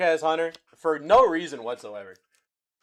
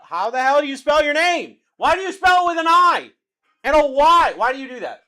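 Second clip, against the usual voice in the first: loud, higher, incredulous rant; loud disbelief at the Y in a name; exasperated outburst; 8 semitones higher, 8 dB louder than usual. Real, not acted. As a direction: demand an answer about the spelling, fed up. Exasperated rant at a name's spelling.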